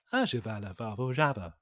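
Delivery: low, soft gibberish babble; Simlish imitation. Shown as a demonstration. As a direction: babble nonsense as if it were speech. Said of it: "it's sort of like".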